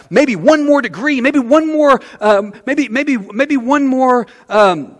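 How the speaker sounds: a hint of a tempted soul grasping; mock craving. Voicing tempted person's cravings.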